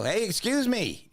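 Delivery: very high, slow, insistent call; retold second try, pitched right up; escalating call. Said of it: "then I'm yelling".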